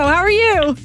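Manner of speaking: usual speaking voice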